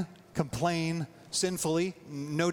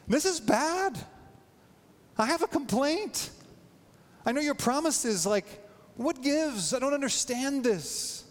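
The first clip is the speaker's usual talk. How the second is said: much higher, pleading and indignant; exasperated complaint; 8 semitones higher than usual. Pretend. A believer complaining honestly to God, exasperated and high-pitched. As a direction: lay your grievance before God, exasperated.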